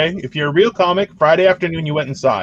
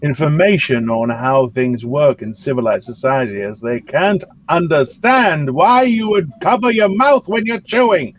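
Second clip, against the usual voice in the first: lordly British accent, played for laughs; 3 semitones lower than usual. Shown as a demonstration. Comic lectures friends on uncivilized Americans, haughty British accent. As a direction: lord it over them in a put-on British accent. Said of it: "just do your best British accent".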